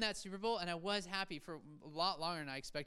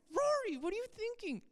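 very high, hammed-up wail of protest; mock exasperation; 3 dB louder than usual. Let-down viewer scolding Rory about the finale, mock exasperation. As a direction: scold in a squeaky, exasperated pitch.

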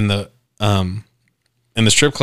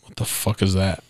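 hushed disbelief; 3 semitones lower, 8 dB quieter than usual. Retold or imitated. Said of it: "I'm like".

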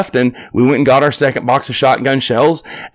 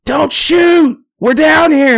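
high, loud yell from down the hill; hollering from afar; 4 dB louder, much slower than usual. Retold or imitated. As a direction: call out loud and high in alarm. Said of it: "we hear these people holler".

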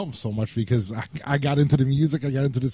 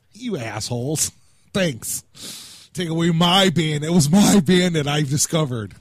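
mock indignant; 4 semitones higher, 5 dB louder, slower than usual. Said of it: "I'm like".

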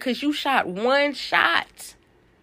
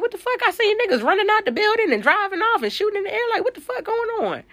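much higher, fast, bewildered brother voice; imitating her brother; 12 semitones higher, faster than usual. Her brother comes back from the store confused, a high, fast imitation of him.

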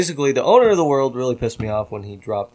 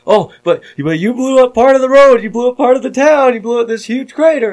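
high, loud mock complaining; retold freak-out; 11 semitones higher, 8 dB louder than usual. Players listing the damage to him, loud mock outrage. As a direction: pile on accusations in a raised, louder voice. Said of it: "everybody in the server lost their fucking minds".

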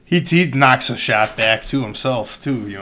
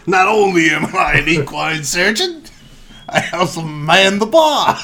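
laughing Cockney bartender; 8 semitones higher, 8 dB louder than usual. Acted.

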